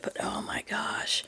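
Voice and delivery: breathy whispered oh my gosh, drawn out; exasperated whisper. Real, not acted. Remembering the one-sided friendship, a hushed exasperated whisper.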